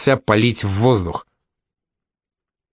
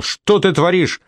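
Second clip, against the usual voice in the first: barked; 8 semitones higher than usual. A narrator's reading. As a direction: snap it at her. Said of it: "рявкнул".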